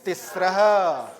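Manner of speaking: تسرها said slowly and clearly, ending with a falling tone; slow Sanskrit demo. Shown as a demonstration. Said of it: "تسرها منجوشا".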